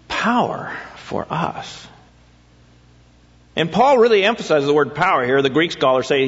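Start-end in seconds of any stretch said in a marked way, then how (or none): 0.1-1.9 slow, breathy whispered question. Acted. Pastor asking the congregation, slow and whispered. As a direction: whisper it slowly, as if musing. Pastor ponders the verse aloud.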